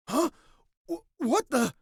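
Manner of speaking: high, loud stammering gasp of shock; startled. Acted. Boyfriend seeing two figures appear, startled stammer. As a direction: blurt it high, stumbling on the words.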